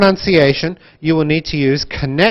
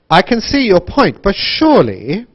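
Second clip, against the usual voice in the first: high, loud swings with "surely" dipping then rising; wide intonation; 5 semitones higher, 5 dB louder than usual. Shown as a demonstration. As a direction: model "but surely" with an exaggerated swoop. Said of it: "think about connected speech and intonation".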